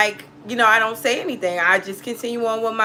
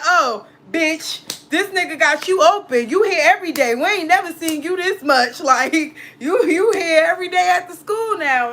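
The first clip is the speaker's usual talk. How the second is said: lightly voiced aunt, higher and teasing; 8 semitones higher, 4 dB louder than usual. Aunt teasing her about the new boyfriend, higher teasing voice. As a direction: tease her like a nosy aunt.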